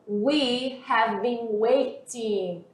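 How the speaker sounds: slow drill reading. Shown as a demonstration. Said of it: "teacher models slowly".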